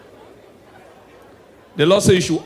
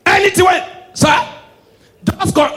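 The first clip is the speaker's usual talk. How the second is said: way up and loud, slow; high slow laughing yell; high laughing shout; 11 semitones higher, 3 dB louder, slower than usual. Real, not acted.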